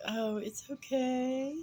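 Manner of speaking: high, slow, swooning reply; starstruck swoon. Retold or imitated. Starstruck woman to players helping her up, high and slow swoon. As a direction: melt a little while brushing it off.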